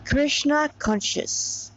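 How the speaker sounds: ordinary delivery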